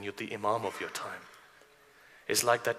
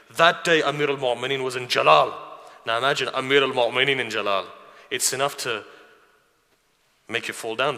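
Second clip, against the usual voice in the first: loud, raised preacher's voice; impassioned preaching; 3 semitones higher, 9 dB louder than usual. Acted. Preacher awing the congregation with the Imam's Jalal, impassioned. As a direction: preach it at full force, voice lifted high and loud.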